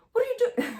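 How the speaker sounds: high, loud mock shock; flustered. Nurse turning on the light and finding him, flustered. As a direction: imitate a startled nurse crying out. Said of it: "she's very flustered".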